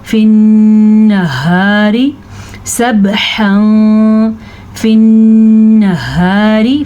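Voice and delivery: फिन्नहारी chanted slowly with long nn and long haa; फिन्नहारी, hummed n and light r; ghunna and madd. Shown as a demonstration. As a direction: hold the nasal n and long vowel in फिन्नहारी. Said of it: "नून का गुन्ना ध्यान रखना".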